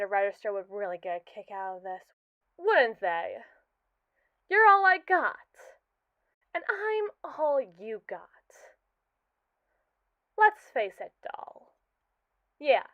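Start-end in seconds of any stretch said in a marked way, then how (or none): 4.5-8.2 emphatic outburst. Acted. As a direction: insist hard on being needed. AI pleads its worth to creator.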